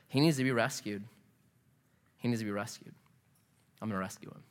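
low, hushed statement of intent; quiet resolve. Retold or imitated. Abraham deciding to rescue Lot, quietly resolved. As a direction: drop low and soft, letting it fade further.

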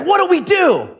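put-on high, panicked squeak of a scared city boy; scared teen hiding from a bear, asking his friend in a high panic. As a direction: play the terrified teen, voice jumping way up. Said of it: "He's like".

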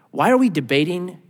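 usual speaking voice

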